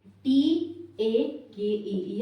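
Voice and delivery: teacher dictating टी ए के ई; slow, emphatic spelling. Shown as a demonstration. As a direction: spell it out firmly for the class. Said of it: "for example, मैं और कहूँ तो".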